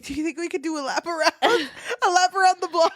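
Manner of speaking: high, laughing ask; shy Penelope laughing as she asks for another lap; giggling plea. Retold or imitated. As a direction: giggle through the request. Penelope stalling in the carriage.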